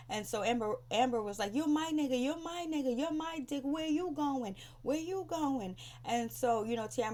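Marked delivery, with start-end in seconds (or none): 1.5-5.8 high-pitched imitation of a taunting woman; mock confrontational. Retelling Amber shouting after her man, mock confrontational. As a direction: call after them, pitched up and insistent.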